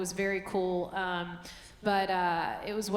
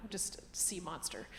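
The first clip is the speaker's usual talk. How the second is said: hushed, breathy throwaway; whispered aside; 3 semitones lower, 13 dB quieter than usual. Real, not acted. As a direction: whisper it like a confession. Self-deprecating aside, whispered.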